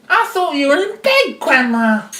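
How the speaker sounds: put-on high, innocent child voice; puzzled youngster speaking up; little girl. Child questions fake grandma.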